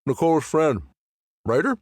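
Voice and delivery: talking through a mouthful of donut; words round a bite of food, mouth full. Acted. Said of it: "I'm talking with my mouth full".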